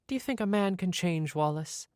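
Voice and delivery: high lady voice; posh lady voice. Retold or imitated. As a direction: ask sweetly as a grand lady. Lady voiced in retold film.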